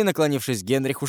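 ordinary delivery, nothing marked

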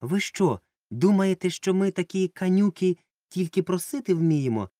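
high, loud indignant question. A narrator's reading. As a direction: sound hurt and offended. Offended protest to grandpa.

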